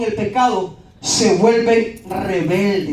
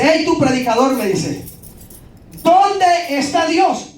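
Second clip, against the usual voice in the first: higher, slow challenging call; confrontational heckle; 7 semitones higher, slower than usual. Retold or imitated. Heckler in the street confronting the preacher, demanding. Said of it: "Me gritó".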